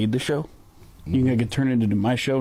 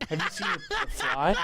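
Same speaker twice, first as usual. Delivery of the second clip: laughing; 6 dB quieter than usual. Acted. Repeats question, breaking up.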